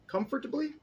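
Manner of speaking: comfortably squeaked up high as a question; puzzled questioning. Shown as a demonstration. Reader doubting the word, high questioning comfortably. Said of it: "What's that fucking word?".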